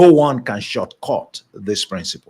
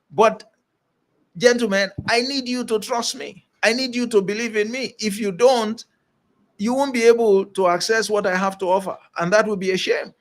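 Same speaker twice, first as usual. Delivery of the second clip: Jesus appealing; 6 semitones higher than usual. Retold or imitated. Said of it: "He was telling them".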